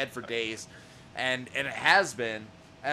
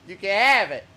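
imitating singer; 6 semitones higher, 9 dB louder than usual. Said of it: "Did he just say".

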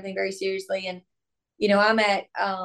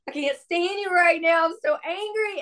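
higher-pitched mock outburst of anger; acting out an angry outburst, raised pitch; retold fury; 8 semitones higher than usual. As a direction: replay being fed up and furious. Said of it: "And I was furious".

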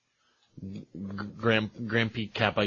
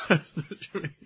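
usual speaking voice

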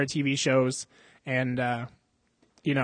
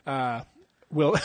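usual speaking voice

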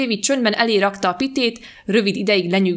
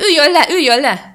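loud, high, pressing invitation; loud eager hostess; eager urging; 8 semitones higher, 9 dB louder than usual. A narrator's reading. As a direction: push him into the chair with your voice. Hostess urges guest to sit.

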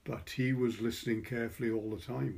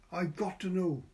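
anxious insistence; 7 semitones higher than usual. A narrator's reading. Edith sobbing, insisting.

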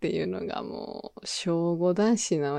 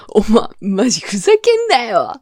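put-on loud flustered boyish snap; retold snapping; 7 dB louder than usual. Snapping at a girl who called her granny, loud boyish outburst. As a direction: blurt it out like a fifth-grade boy lashing out. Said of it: "のキレ方".

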